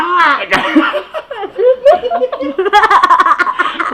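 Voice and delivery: real helpless laughing fit; prolonged high-pitched laughter; long high laugh. Real, not acted. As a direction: crack up at the pupil's answer. Teacher cracks up at answer.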